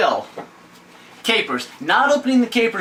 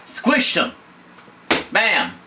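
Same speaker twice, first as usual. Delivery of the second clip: usual speaking voice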